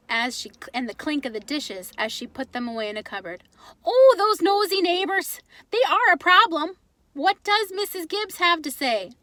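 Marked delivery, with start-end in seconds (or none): 3.9-9.1 put-on higher, louder, accented mother tutting; Ma's voice. Mother reacting to the letter while doing dishes, tutting. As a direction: cluck sympathetically over the neighbors.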